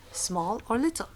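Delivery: ordinary delivery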